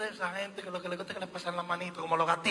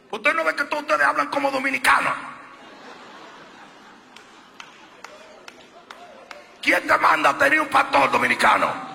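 sermon climax shouted over the music; full-force preaching; 10 semitones higher, 11 dB louder than usual. Acted. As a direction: hammer each name out to the congregation.

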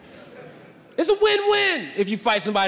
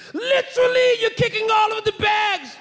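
put-on yelled British accent. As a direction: yell like a bad British impression. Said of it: "He's yelling at me in British".

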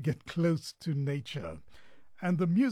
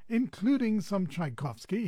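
normal talking voice

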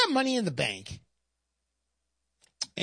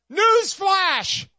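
shouted newsflash; 11 semitones higher, 6 dB louder than usual. Real, not acted. Shouting headline.

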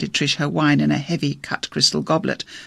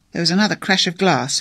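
plain reading voice

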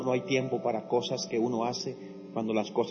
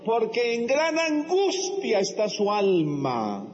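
higher, slow tender plea; prophet's voice; 7 semitones higher, slower than usual. Acted. Prophet defending the grieving woman, higher and slow. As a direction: lift the pitch and slow right down. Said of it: "le dijo".

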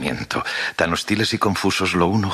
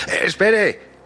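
high-pitched holler after someone; raised, straining cry while giving chase; shouted call; 3 dB louder, much slower than usual. A narrator's reading. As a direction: call out urgently to stop him. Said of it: "le grité corriendo tras él".

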